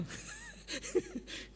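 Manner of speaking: a quick airy giggle, high up; breathy high giggle. Real, not acted. Preacher giggling at his own joke to the congregation, breathy laugh.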